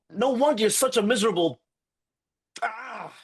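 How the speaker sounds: losing it mid-insult, choking on the last word; exasperated outburst. Acted. Boyfriend storming out at his girlfriend, high strangled outburst. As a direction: voice shoots up high and breaks off in a strangled cry. Storming out after a donut fight.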